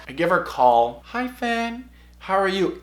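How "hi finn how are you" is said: high, soft mom voice; soft high-pitched mock mom; mom answering phone. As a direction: greet lightly as an imitated mom. Acting out mom answering call.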